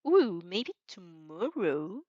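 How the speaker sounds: slow, teasing drawl; slow drawn-out tease. Real, not acted. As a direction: draw it out playfully.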